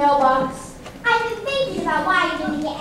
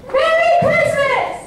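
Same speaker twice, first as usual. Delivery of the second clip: flustered blurt; 10 semitones higher, 9 dB louder, much slower than usual. Acted. Nervous slip, much higher.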